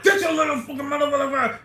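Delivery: high, loud, fast mock yell at a kid; imitating a parent yelling at a child, half laughing; mock barking parent. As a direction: bark it fast like a furious parent. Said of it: "always barking at their kids like that".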